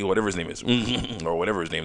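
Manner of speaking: normal talking voice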